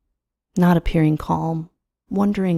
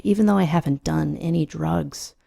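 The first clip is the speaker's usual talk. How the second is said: plain reading voice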